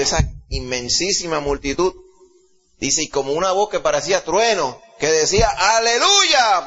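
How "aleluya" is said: loud, high cry of praise; thunderous hallelujah. Acted. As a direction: ring it out like thunder. Multitude's thunderous voice quoted. (aleluya atronador)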